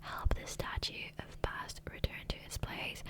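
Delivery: normal talking voice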